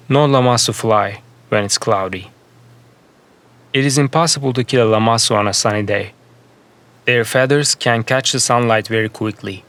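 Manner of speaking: ordinary delivery, nothing marked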